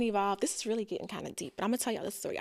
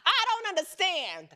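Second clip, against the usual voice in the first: mock coach pep talk; 7 semitones higher, 4 dB louder than usual. Voicing coach again.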